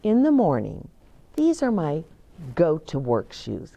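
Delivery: ordinary delivery, nothing marked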